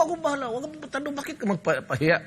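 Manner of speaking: fast, high, lightly voiced reassurance; quick reassurance. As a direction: rattle it off like a quick promise. Retelling his own reply.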